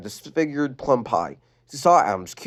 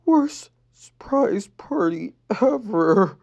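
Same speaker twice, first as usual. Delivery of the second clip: muttered; 9 semitones higher, much slower than usual. Acted. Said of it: "she muttered".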